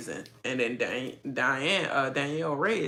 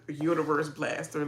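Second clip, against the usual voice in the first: usual speaking voice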